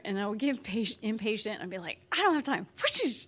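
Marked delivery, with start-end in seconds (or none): 2.1-2.7 louder, higher impatient snap; no more patience, just rip it; impatient mom. Retold or imitated. Impatient mom ripping Band-Aid. 2.8-3.2 quick ripping whoosh sound. Retold or imitated. Imitating Band-Aid ripped off.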